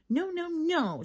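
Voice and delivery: a mild mock growl; grumpy little no's, growling them out; a kid's half-growled protest. A narrator's reading. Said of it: "she growled".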